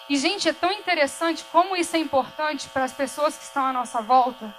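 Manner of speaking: ordinary talk, nothing marked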